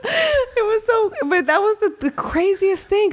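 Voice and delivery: words riding on a laugh; laughing hard. Real, not acted. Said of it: "forgot how we even got on this".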